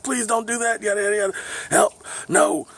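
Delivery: high-pitched imitation of begging; mock pleading. Teens begging police not to contact parents, high mock pleading. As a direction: beg in a hammed-up panic.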